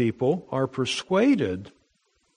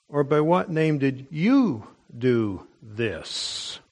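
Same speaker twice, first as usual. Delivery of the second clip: "you" pitched up and drawn out, slow line; stress on you; slower than usual. Acted. Preacher reading the council's question to Peter, stress on "you". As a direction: lean hard on "you", stretching it out. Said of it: "right to emphasize the word "you".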